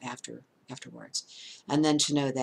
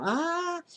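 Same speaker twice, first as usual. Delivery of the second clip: very high sustained mock scream; imitated hollering scream. Mother and son fleeing a man, imitated high holler. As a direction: holler a wordless scream, pitched way up. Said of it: "just hollering".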